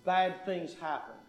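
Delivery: usual speaking voice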